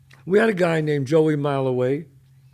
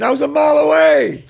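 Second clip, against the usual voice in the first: much higher, louder desperate insistence; insisting louder; 13 semitones higher, 9 dB louder than usual. Retold or imitated. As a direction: keep repeating it, rising in panic. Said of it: "he kept saying".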